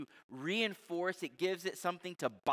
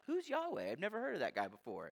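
genuinely curious; 6 dB quieter, faster than usual. Shown as a demonstration. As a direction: ask it as if honestly baffled by "Who's". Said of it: "like, pure inquisition".

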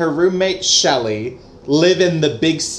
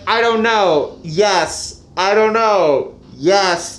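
mock wavering; 4 semitones higher, slower than usual. Acting out a character's wavering.

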